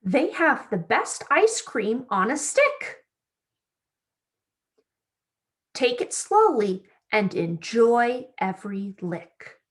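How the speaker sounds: bright, lively storybook read-aloud; reading to children. A narrator's reading. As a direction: read it like story time for little kids. Reads picture book to children.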